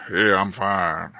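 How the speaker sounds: slow, low-key imitation of a husky voice; deep woman's voice. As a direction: lightly impersonate a woman with a deep voice. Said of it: "And she had a real deep voice".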